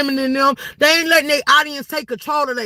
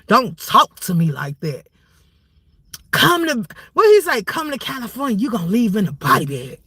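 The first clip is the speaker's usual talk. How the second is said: mock feminine voice; 5 semitones lower than usual. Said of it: "you got so feminine".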